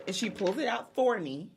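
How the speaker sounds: ordinary talk, nothing marked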